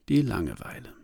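usual speaking voice

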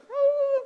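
very high squealed "ah"; cartoon squeal of inner turmoil; high mock wail. Noise of the inner war.

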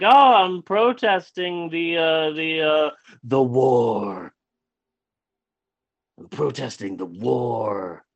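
slow, stalling, mildly awkward reenactment; nervous improvised excuse. Retold or imitated. Nervous man explaining to his date why he kicks her, stalling. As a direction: stretch it out as if inventing it on the spot. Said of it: "I got really nervous".

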